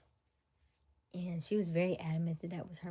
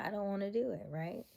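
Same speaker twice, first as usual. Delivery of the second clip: ordinary talk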